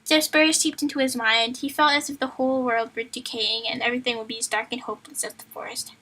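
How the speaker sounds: usual speaking voice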